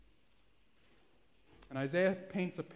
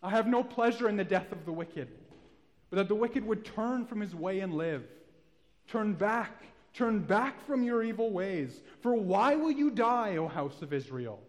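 loud, high pleading appeal; earnest loud plea that peaks on why; 3 semitones higher, 6 dB louder than usual. Acted. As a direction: call the stubborn people back home. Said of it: "This is the same tone".

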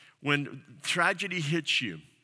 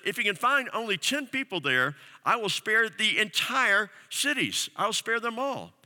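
higher, louder, rising proclamation; preacher voicing God's promise, climbing in pitch; proclaiming God's promise; 7 semitones higher, 3 dB louder than usual. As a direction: lift the voice higher with each line of the promise.

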